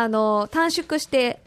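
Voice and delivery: ordinary talk, nothing marked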